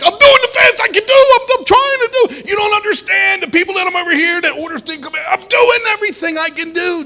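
an overworked maker shouting back at impatient people; frantic high shout of a busy God; God pleading overwhelmed. Retold or imitated. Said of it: "he's like".

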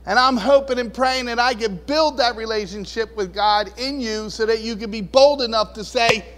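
normal talking voice

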